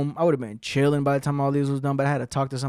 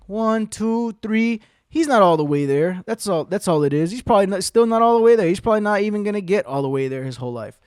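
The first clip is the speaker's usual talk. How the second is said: usual speaking voice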